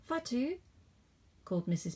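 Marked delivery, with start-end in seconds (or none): 0.0-0.6 high sing-song call. Acted. Missus Bojang calls Fatu from the mango tree, high call. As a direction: hail the girl passing by.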